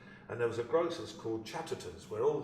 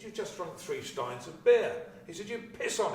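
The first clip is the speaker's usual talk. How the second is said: excited; 8 semitones higher, 5 dB louder than usual. Retold or imitated. Director's excited outrageous instruction.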